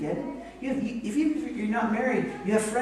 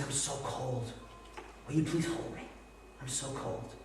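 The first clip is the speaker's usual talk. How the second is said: very soft, weak plea; imitated sick wife; 4 semitones lower, 11 dB quieter than usual. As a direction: quietly imitate a shivering sick person. Sick wife shivering, asks to be held.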